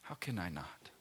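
soft, almost whispered, heartfelt; hushed earnest question. Real, not acted. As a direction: ask it quietly to yourself.

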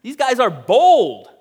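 astonished leaders exclaiming at the apostles' boldness; bewildered exclamation. Acted. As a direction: gasp it out in amazement. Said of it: "They're bewildered".

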